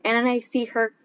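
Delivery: normal talking voice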